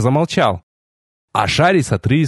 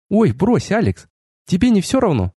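dismissive female voice; 6 semitones higher than usual. A narrator's reading. Narrator voices the dismissive goddess.

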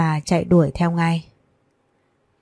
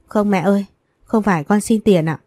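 usual speaking voice

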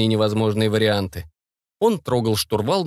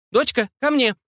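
high, urgent motherly order; high-pitched insistent call; anxious command; 12 semitones higher than usual. A narrator's reading. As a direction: order your daughter back, pitch raised. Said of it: "приказала мать Екатерины".

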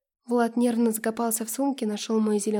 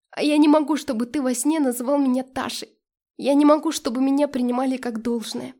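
pained outburst; 2 semitones higher, 6 dB louder than usual. Acted. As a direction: burst out, unable to put up with it. Heroine's outburst in lovers' quarrel. (надрывно, громко, с болью)

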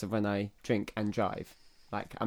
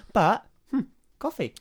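dreamy hmm; 9 semitones higher, 5 dB louder than usual. Pretend. Savouring coffee thought.

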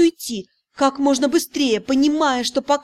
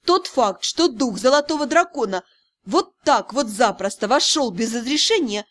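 usual speaking voice

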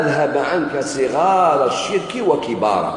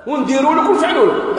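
threatening minister; 11 semitones higher than usual. Retold or imitated. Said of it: "قال له".

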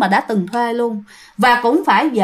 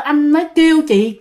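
usual speaking voice

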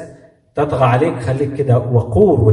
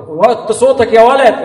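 high, slow mock telling-off; raised-voice scolding; 11 semitones higher, 4 dB louder, slower than usual. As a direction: raise your voice at a boy in a put-on scolding. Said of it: "اللي بعلي صوته كده".

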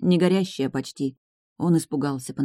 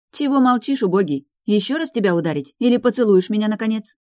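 higher, pushy questioning; impatient challenge; 5 semitones higher, 4 dB louder than usual. A narrator's reading. Enina daring Velizar to kiss her, impatient challenge. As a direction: demand an answer, pitch lifted.

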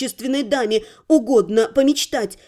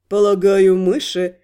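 slow, lower, knowing mage voice; mysterious mage; 7 semitones lower than usual. Acted. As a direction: let a secret smile sit in the words. Incubus mage, same voice.

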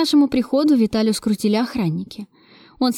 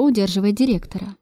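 ordinary delivery

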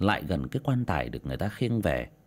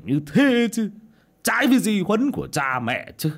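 raised, high-pitched indignation; indignant outburst; 12 semitones higher, 5 dB louder than usual. A narrator's reading. Old man deploring his wife's deed to his friend, indignant. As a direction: protest loudly in a higher voice. Lão Điểu scolds about his wife.

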